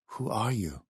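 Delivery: put-on hushed, accented, wary question; soft question. A narrator's reading. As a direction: ask it softly, well below normal volume. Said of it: "he asked softly".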